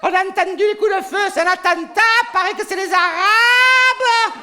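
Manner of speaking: voix très aiguë et forte, ralentie à la fin; la foule s'emballe et crie à l'attentat; rumeur affolée. Acted. (un passant affolé propage la rumeur, voix perchée criée)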